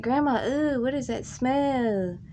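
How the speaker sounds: grossed out. Retold or imitated. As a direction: wrinkle your nose and whine it. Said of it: "I was like".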